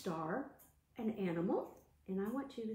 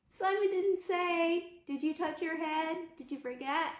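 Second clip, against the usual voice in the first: mock gotcha; 6 semitones higher, 4 dB louder than usual. Teacher catching kids out.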